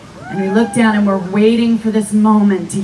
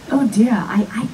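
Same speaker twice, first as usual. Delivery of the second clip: whispered, laughing worried aside; worried old gentleman; 3 semitones higher than usual. Retold or imitated. Old actor whispering his doubt to her on set, half-laughing. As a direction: murmur it like a doubtful old Englishman. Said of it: "he goes".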